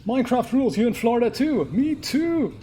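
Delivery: high-pitched delighted exclamation; excited. Real, not acted. Streamer finding a viewer also in Florida, excited.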